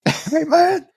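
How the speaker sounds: high-pitched mock Southern accent. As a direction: play it up as a high Southern-accented role player. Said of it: "always have a Southern accent".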